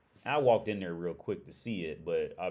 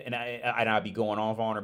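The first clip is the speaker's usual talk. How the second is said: ordinary delivery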